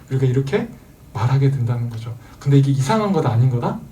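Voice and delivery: ordinary delivery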